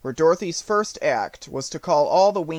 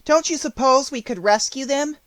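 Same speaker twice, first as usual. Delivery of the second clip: put-on high-pitched anxious little girl; worried girl's raised pitch; 9 semitones higher, 4 dB louder than usual. A narrator's reading. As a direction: ask hopefully but worriedly, as a child. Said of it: "asked the girl anxiously".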